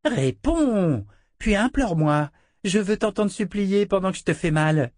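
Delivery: high, mocking little-girl voice; cruel child. A narrator's reading. Child princess taunting her captive Richard, high sneering voice.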